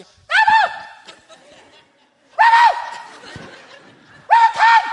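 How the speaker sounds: very high squeaky shout; tiny piercing toddler holler; little daughter copying dad. Retold or imitated. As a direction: squeal the dog call like a three-year-old. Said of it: "Arya comes around the corner".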